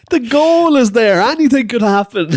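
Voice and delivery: incredulous outburst; exasperated disbelief. Real, not acted. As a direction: throw up your hands at the ref. Incredulous rant about referee.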